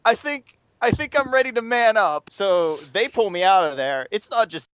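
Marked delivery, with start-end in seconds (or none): 0.0-2.3 put-on sheepish drunk. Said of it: "and I hear".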